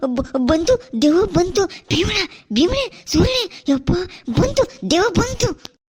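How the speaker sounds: high, loud terrified cries for Bhima; panicked shout. Acted. Villager shrieking to friends that a ghost has come. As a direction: scream that the ghost is coming.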